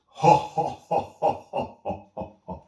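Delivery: loud mocking spirit cackle; evil laughter. Spirit mocking the hunter's threat, loud evil cackle. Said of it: "The figure laughed".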